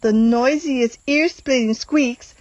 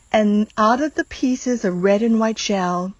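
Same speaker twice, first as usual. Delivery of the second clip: plain reading voice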